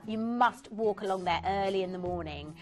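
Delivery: usual speaking voice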